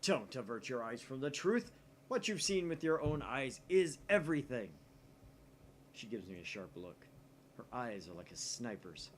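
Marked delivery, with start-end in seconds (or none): 0.0-4.7 loud, higher, theatrical insistence; dramatic declaration. Acted. Challenging the genius girl, a loud theatrical declaration. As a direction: hammer the truth home. Okabe lectures Kurisu dramatically.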